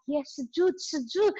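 pitched well up, rising "sujood" twice; educator's voice. Retold or imitated. Educator repeats the prayer word to the mum, imitated high and rising. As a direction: mimic the educator catching on.

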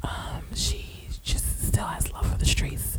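breathy ASMR whisper, unvoiced. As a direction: whisper close to the mic like an ASMR video. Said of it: "A S M R review".

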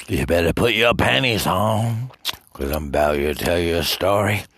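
hammed-up government voice; mock government announcement. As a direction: bellow it like a state broadcast. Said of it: "the Chinese government told all them citizens".

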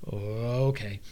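low, quiet "oh, okay"; deflated reaction. Retold or imitated. Player retelling the sudden stop on the rope, quietly taken aback. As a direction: say it small and low.

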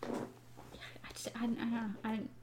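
unvoiced, trailing whisper. Real, not acted. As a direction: breathe it out, unsure. Trailing off, unsure.

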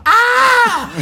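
loud high shriek with a laugh; startled scream. Retold or imitated. Cellmate jumping back from the slashed gearhead, loud shriek. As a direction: yelp in fright, half laughing. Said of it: "So I've just gone".

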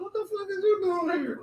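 high, hammed-up shocked mother; mock surprised mom. Mom finding her runaway son at her door, hammed-up high shock. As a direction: shriek it out like a mom who can't believe it.

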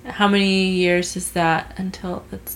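normal talking voice

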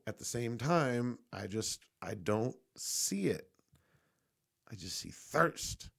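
ordinary delivery

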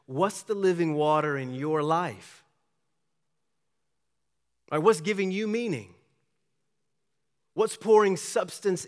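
fervent preaching. Acted. Pastor presses the congregation about their source, fervent preaching. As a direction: drive the questions home to the congregation.

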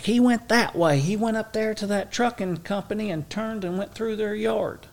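put-on high, helpful old woman; mock old woman giving directions; old lady voice. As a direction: play the chatty old lady pointing the way. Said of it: "She's like".

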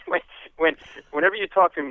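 normal talking voice

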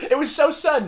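high, loud words through laughter; can't stop laughing. Real, not acted. Still laughing.

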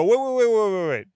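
desperate stop-him calls; frantic interrupting. Retold or imitated. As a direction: cut him off in a panic. Frantically cutting off the agent.

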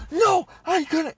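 slow, very high mock refusal; imitated yelling back. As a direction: holler it back in mock protest. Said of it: "were yelling back".

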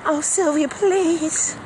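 high, pleading voice of a desperate parent; mock begging parent. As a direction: whine it up high like they suddenly need her. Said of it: "if it was their baby, they'd be running".

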